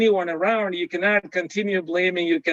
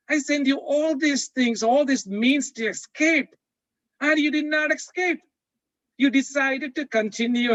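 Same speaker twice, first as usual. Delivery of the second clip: God scolding; 6 semitones higher than usual. Acted. God scolding the stubborn pastor.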